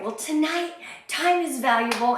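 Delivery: normal talking voice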